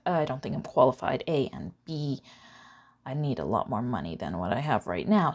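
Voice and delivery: ordinary talk, nothing marked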